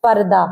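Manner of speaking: पर्दा said with the final ha silent, ending on a. Shown as a demonstration. Teacher showing students पर्दा with no final h. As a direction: say पर्दा with no h at the end. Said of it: "तो कैसे पढ़ेंगे".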